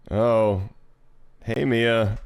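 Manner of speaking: understated hiss of displeasure. Acted. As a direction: greet her with a touch of venom. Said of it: "Olivia's words are a hiss".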